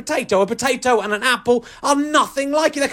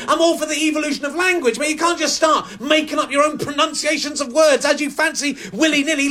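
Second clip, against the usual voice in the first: ordinary talk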